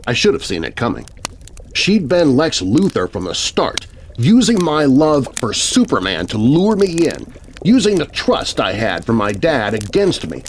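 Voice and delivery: ordinary delivery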